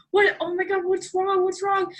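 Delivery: high-pitched imitation of a panicked parent; alarmed dad.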